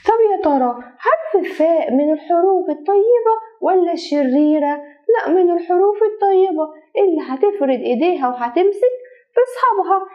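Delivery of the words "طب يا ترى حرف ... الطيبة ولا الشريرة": usual speaking voice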